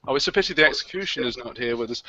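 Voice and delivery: ordinary talk, nothing marked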